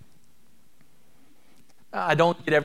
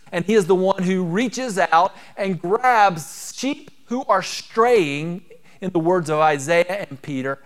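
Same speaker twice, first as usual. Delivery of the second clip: louder, rising preacher's cadence; full-force preaching; 4 semitones higher, 5 dB louder than usual. Acted. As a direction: build the congregation up, punching each claim. Preaching about God's character.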